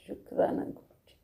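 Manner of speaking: breathy whispered thanks to the guru; whispered prayer. Real, not acted. प्रार्थना में धन्यवाद दोहराती. (फुसफुसाकर प्रार्थना)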